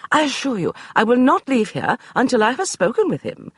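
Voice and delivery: high, brisk dismissal; imperious lady; commanding lady. Acted. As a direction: brush him aside like a general. Beatrice voiced.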